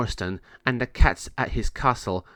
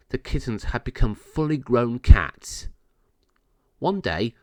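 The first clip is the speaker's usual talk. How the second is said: plain reading voice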